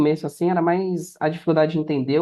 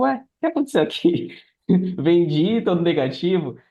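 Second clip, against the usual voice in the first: much higher, baffled cry breaking into a laugh; retold shock; 6 semitones higher than usual. Seller recalling checking his first sales report, baffled and laughing. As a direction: replay the moment of disbelief, laughing. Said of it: "eu falava".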